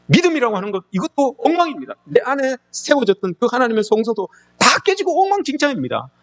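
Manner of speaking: high, pleading cry to God; anguished lament. Acted. A believer pleading to God over ruin, high anguished cry. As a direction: plead as if life lay in ruins. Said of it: "이렇게 이야기를 하는 거예요".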